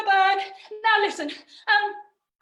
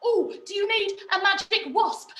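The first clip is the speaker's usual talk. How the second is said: ordinary talk, nothing marked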